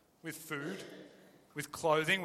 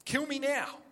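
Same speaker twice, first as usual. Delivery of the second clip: petulant Jonah; 7 semitones higher than usual. Retold or imitated. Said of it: "That's what he says".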